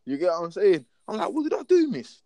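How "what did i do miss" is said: high-pitched retold plea of innocence; indignant protest. Pupil asking the teacher what he did, indignant.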